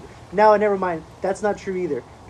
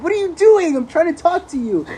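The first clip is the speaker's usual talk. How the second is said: mock yell; 12 semitones higher, 9 dB louder than usual. Calling out to the other car.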